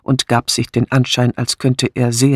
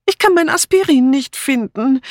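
very high, pitiful wail; plaintive; 14 semitones higher, 3 dB louder than usual. A narrator's reading. Dora back downstairs without her aspirin, plaintive. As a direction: whine it high and helplessly. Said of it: "erklärte Dora kläglich".

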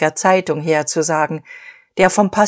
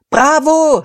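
much higher, loud cheer; crowd shout; 10 semitones higher, 6 dB louder than usual. A narrator's reading. Suppliers cheering Dieterich, loud high shout. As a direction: yell it like a roaring crowd. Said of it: "schrien die Lieferanten".

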